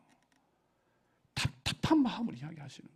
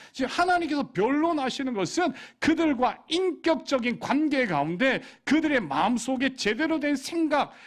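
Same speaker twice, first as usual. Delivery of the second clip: raised, impassioned sermon voice; fervent preacher voicing God's lament; preaching at full force; 7 semitones higher, 5 dB louder than usual. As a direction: drive it home like a pleading preacher.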